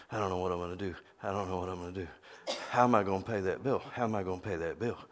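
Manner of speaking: low, soft anxious muttering under the breath; worried muttering. Retold or imitated. Preacher imitating a worrier pacing the living room, muttering. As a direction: fret to yourself, mumbling the worries. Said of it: "walk around your living room saying".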